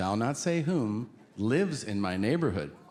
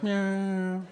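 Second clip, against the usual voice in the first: a higher, drawn-out cat meow; imitated cat meow; 7 semitones higher than usual. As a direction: meow like a cat, playfully odd. Senator answers with a meow.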